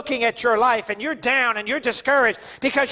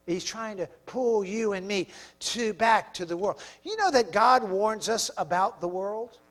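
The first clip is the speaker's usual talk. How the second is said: usual speaking voice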